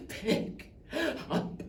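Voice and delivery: breath giving out on each word; fading desperate plea. Acted. Dad begging his child to pick up, spent. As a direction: gasp it out softly with a gap between words.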